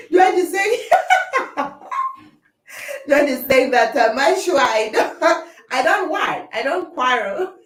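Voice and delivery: laughing through the words; giggling, caught in her own claim. Real, not acted.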